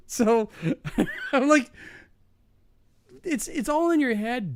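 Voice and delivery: high laughing delivery; giggling through the line. Real, not acted. Teller cracking up while telling the coworker it's in his head.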